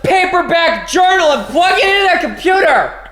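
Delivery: excited laughing shout. Real, not acted. Laughing excited shout about USB.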